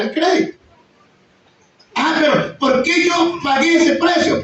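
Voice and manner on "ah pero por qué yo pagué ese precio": slow, high, shouted lament; preacher shouting his past regret to the church; exasperated complaint. Retold or imitated. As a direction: cry out in frustration. His own complaint retold.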